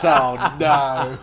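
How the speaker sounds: laughing it off; bursting into laughter. Real, not acted. Laughs at a made-up plane.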